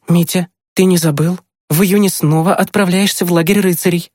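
high woman's voice with a reminder; reminding mother. Acted. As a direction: remind a son in a high, lively voice. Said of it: "напомнила мама". (мама напоминает высоким голосом)

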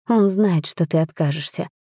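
usual speaking voice

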